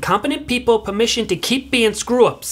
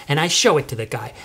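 normal talking voice

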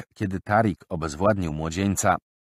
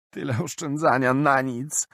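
mild weeping lament; 8 semitones higher than usual. Acted. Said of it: "zapłakał".

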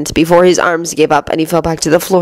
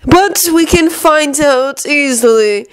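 slow, drawn-out villain voice, pitched up; Lucius; 9 semitones higher, much slower than usual. A narrator's reading. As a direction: stretch the words out menacingly. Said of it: "came Lucius's voice".

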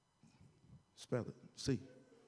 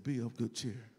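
very soft, low spoken reassurance; nice, simple tone; 6 semitones lower, 5 dB quieter than usual. Acted. Jesus speaking to frightened men in the storm, softly and calmly. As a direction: say it quietly and simply, no shouting. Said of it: "He just simply spoke to 'em in a nice tone voice".

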